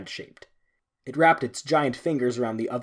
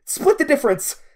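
loud, much higher shout; yelling; 14 semitones higher, 8 dB louder than usual. A narrator's reading. Narrator begging the god to dim the light, high yell. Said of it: "I yelled".

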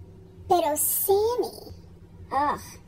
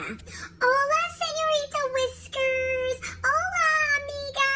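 much higher, friendly hello called from the street; neighbors calling out; 11 semitones higher than usual. Acted. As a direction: sing out the greeting up high. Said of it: "la gente siempre me grita".

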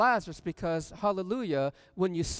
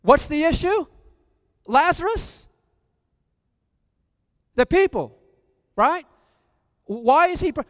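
high, loud preaching to the congregation; preaching at full force; 7 semitones higher, 8 dB louder than usual. Real, not acted. Preacher pressing the congregation, loud fervent questions. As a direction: drive the question home to the crowd.

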